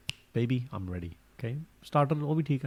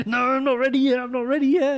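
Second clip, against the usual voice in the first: much higher, louder, flustered put-on voice; wife telling the waiter she's not ready, mock flustered; 14 semitones higher, 4 dB louder than usual. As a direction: panic about not being ready. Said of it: "she's like".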